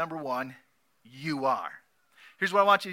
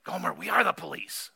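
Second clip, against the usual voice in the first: mild exasperation; 3 semitones higher than usual. A narrator's reading. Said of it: "In utter exasperation, Barney shoots back".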